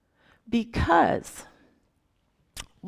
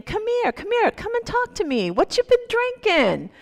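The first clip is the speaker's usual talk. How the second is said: put-on much higher, sing-song friendly old man; lilting old man; 13 semitones higher, 4 dB louder than usual. Kind elder invites the angry drunk over in a lilting voice. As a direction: coax him over like a kindly grandfather. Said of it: "this lilting voice".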